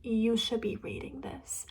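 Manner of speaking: whispered, like a stern hint; mock whisper. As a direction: hiss it out as a warning from the parents. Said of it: "And they say".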